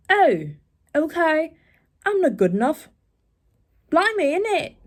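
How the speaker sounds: put-on high, playful British accent. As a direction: ham up a London voice, blimey and all. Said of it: "I don't know why I have switched to this".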